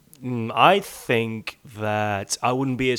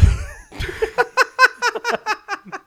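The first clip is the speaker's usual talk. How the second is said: high wild cackle. Real, not acted. Big laugh.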